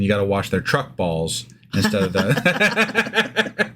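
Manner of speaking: chuckling as the punchline lands; giggling through the joke; laughing through words. Real, not acted. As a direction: voice breaking into a laugh. Joke about washing truck balls.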